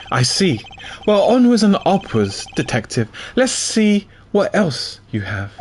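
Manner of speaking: prosecutor character voice. Acted. Reads prosecutor character lines.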